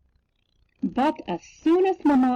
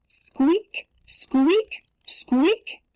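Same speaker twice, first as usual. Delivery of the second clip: high rising squeaks, evenly spaced; squeaking; 8 semitones higher, much slower than usual. A narrator's reading. Narrator imitates the noisy bed, high rising squeaks. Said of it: "the noise was even louder".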